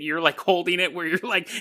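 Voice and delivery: usual speaking voice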